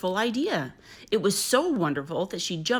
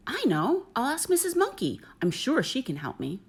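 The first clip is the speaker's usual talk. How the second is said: much higher, bright burst of an idea; excited new idea; 7 semitones higher than usual. Acted. Kangaroo mother suddenly thinks of asking the monkey, excited.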